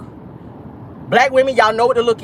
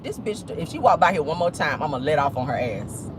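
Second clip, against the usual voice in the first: muttered threat; 5 semitones lower, 6 dB quieter than usual. Retold or imitated. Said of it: "I was just like".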